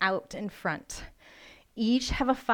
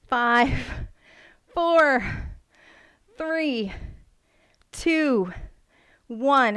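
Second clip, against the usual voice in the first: rising countdown shout; 3 semitones higher, 4 dB louder, much slower than usual. Real, not acted. Instructor urging the class through the final reps, loud countdown. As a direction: drive the final numbers home like a cheer.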